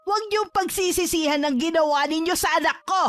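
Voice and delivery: high-pitched, ringing warning; angry threat. Acted. Mother warns the pair they will regret it, angry and threatening.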